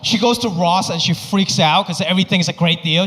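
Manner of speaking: usual speaking voice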